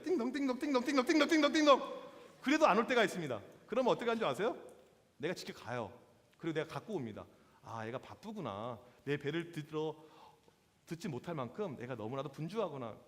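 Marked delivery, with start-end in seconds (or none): 0.0-1.5 rapid-fire imitated call-bell chimes, rising in volume; repeated bell ringing. Diner pressing the restaurant bell ten times, insistent chiming. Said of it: "열 번까지 눌러요". 7.6-12.9 low, soft, musing to yourself; understanding murmur. Acted. Pastor fetching it himself as staff are busy, understanding. Pastor voices his own forgiving thought.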